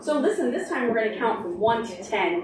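plain reading voice

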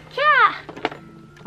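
long high squeal of delight. Real, not acted. Unboxing for viewers, a long high delighted squeal. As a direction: squeal like you just saw something adorable. Squealing over a cute item.

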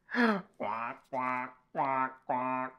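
short playful quacking; duck quacks. Pretend. Reader showing children the yellow duck, playful quacks. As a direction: quack like a duck for little kids.

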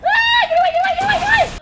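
very high, loud frightened shrieking; panicked scream. Real, not acted. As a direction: scream to drive off an attacker. Panics at enemy in game.

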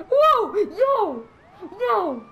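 high, loud yelps of alarm; startled shout. Real, not acted. Player startled in the game, shouting in surprise. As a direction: shout it out, caught off guard.

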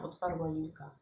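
plain reading voice

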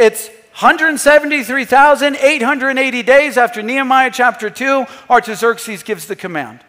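ordinary talk, nothing marked

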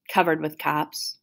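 usual speaking voice

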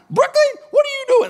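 very high-pitched, quick mock scolding; hammed-up parent catching a child out; mock scolding dad. Daughter mimics dad catching her at fountain, mock scolding. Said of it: "I don't talk like that".

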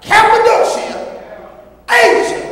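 high, loud preaching with drawn-out phrases; full-force preaching. Real, not acted. Preacher proving new birth to congregation, full-force preaching. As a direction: hammer each name and phrase home.

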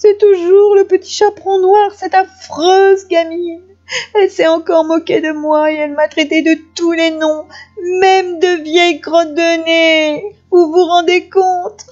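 high, whining, hammed-up crying; sobbing wolf. A narrator's reading. Wolf telling grandmother of the girl's insults, tearful wail. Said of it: "répondit le loup entre deux hoquets".

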